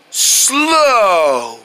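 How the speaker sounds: low, long drawn-out SLOW; slow demo. Shown as a demonstration. Showing friends going slow in the game, drawn-out slow. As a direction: stretch slow out, sliding down. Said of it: "how slow I can go".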